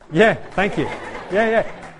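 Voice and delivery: loud, high retold thanks; flustered thanks. Tuba player answering his hero's praise, loud laughing thanks. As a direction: call back your thanks with a laugh.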